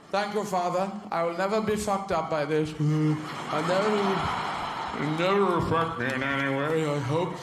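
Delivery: a hint of a polite aristocratic child, partly slowed; dutifully thanking father, a bit stiff; posh little boy. Posh boy answers his father.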